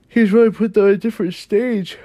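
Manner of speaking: talking through a yawn; words stretched by a big yawn. Real, not acted. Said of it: "I just yawned".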